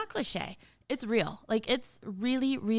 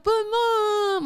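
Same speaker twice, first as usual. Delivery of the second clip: put-on whiny kid; 12 semitones higher, 6 dB louder than usual. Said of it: "Don't be like".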